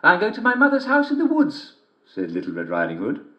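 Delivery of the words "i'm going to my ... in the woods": put-on high young girl voice; girl's voice. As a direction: answer brightly like a little girl. Girl answers wolf.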